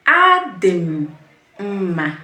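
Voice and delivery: "Adị m mma" slow, pausing before "mma"; slow broken-up model. Shown as a demonstration. Teacher models the reply for beginners, "Adị m mma" slow and split. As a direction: stretch "Adị m", pause, then "mma". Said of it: "Say it with me".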